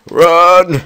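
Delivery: a high frantic shout to flee; panicked yell. Real, not acted. Gamer fleeing a mob, yelling in panic. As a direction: shout it high and loud, running away.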